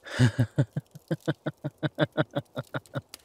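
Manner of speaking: drawn-out helpless chuckling; long laugh. Acted. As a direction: laugh heartily at being teased. Laughs at partner's teasing comment.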